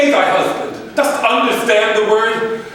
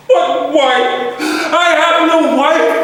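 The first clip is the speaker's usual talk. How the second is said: anguished; 6 semitones higher, slower than usual. Acted. Grief breaking through.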